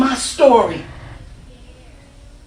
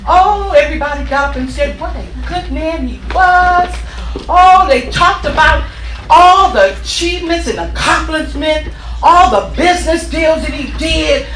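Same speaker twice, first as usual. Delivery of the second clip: loud, higher-pitched preaching; preacher painting the funeral loudly; fired-up preaching; 5 semitones higher, 8 dB louder than usual. Acted. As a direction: build the crowd with energy.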